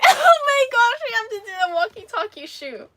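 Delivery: high, loud laughing squeal; laughing excitement. Real, not acted. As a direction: blurt it out through giggles, very high. Delighted by the shoe joke.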